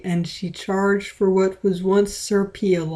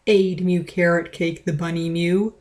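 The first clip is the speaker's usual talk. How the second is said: ordinary delivery, nothing marked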